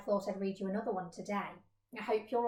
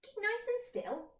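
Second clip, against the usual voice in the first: much higher, softer buzzing voice; buzzing flies; 11 semitones higher, 5 dB quieter than usual. Acted. Flies telling the mole to hold still, buzzing. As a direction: buzz it like a fly. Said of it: "buzzed the flies".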